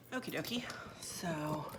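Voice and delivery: soft unvoiced whisper, slowing down. Real, not acted. Vlogger back at the table, whispering to viewers. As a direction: whisper it so as not to disturb anyone. Back after carrying lantern over.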